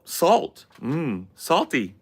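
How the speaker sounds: high, looping canned reactions; NPC bot voice. Acted. Streamer acting as an NPC bot reacting to viewer gifts. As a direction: repeat each word like a streaming NPC. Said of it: "You guys wanna try a bot stream".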